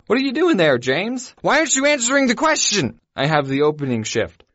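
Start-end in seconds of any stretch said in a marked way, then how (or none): 0.1-3.0 mock suspicious boss. Boss voiced on phone.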